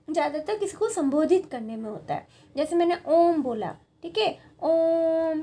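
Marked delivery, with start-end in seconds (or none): 4.6-5.4 "ओम" said slowly with the vowel held long; long drawn om. Shown as a demonstration. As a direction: pull "ओम" out slowly. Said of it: "ओम लंबा खींच लिया". ("ओम" को धीमे लंबा खींचें)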